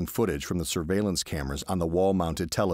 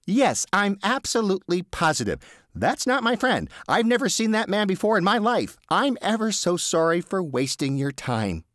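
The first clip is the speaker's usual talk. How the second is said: higher, overeager denial; flustered Teddy; 5 dB louder than usual. Acted. Teddy lying to the officer, higher flustered denial. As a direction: protest too much, nervously.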